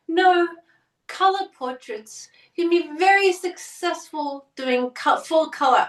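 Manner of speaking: French neighbor urging color portraits, mock French accent. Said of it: "I will stop doing that accent".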